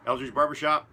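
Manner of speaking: punchy announcer plug; sponsor plug. Acted. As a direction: sell the barbershop like a radio spot.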